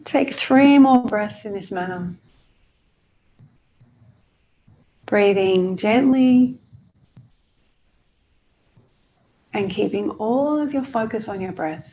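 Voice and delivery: unhurried meditation guide with long pauses; guided meditation. Acted.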